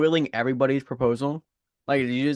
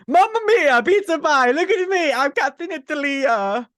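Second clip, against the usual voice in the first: put-on Italian accent; 14 semitones higher, 8 dB louder than usual. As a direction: ham up a cartoon Italian accent. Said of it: "just do the stupid Italian accent".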